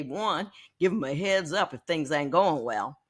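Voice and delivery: ordinary talk, nothing marked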